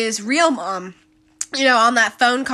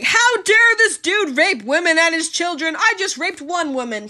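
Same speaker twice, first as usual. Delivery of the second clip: lightly higher mock of a smug man; 7 semitones higher than usual. Reviewer mocking AJ's smug self-justification, lightly higher. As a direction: play him self-righteous, a bit higher. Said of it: "AJ insinuating, like".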